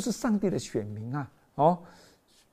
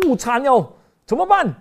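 higher, louder panicked wail; panicked cry; 9 semitones higher, 6 dB louder than usual. Acted. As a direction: Philistines dreading the ark. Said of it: "我们就". (惊慌失措地叫)

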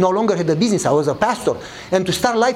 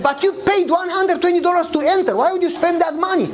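pitched up high, baffled consul; surprised officer; 8 semitones higher than usual. Retold or imitated. Officer baffled by his refusal to want a visa, high and incredulous. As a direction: ask it as if the choice makes no sense. Embassy officer questions him.

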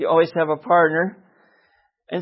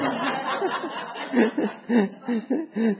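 high, helpless chuckling; long laugh; 6 semitones higher, 5 dB quieter than usual. Real, not acted. Laughs at helpmeet mix-up.

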